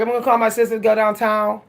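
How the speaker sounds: fast, slurred Pittsburgh accent; Pittsburgh accent with word endings swallowed; real Pittsburgh accent. Shown as a demonstration. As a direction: run it together in thick Pittsburgh speech. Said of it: "That would be my real Pittsburgh accent".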